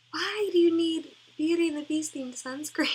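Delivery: loud, baffled protest; indignant rant. Real, not acted. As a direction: demand an answer from the marketers. Ranting about themed sunscreen.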